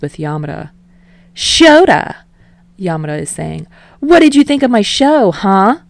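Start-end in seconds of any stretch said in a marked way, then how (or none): 1.3-2.2 loud, much higher shout of a name; calling out. A narrator's reading. Yamada calling out to Aizawa. 4.0-5.8 much higher, loud brash demand. A narrator's reading. Yamada demanding praise, calling loudly.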